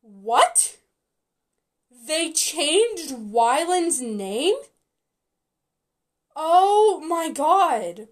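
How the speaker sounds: loud, drawn-out shock; aghast at the news, slow and loud; shocked disbelief. Real, not acted. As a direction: stretch the words out in loud disbelief. Shocked at a changed name.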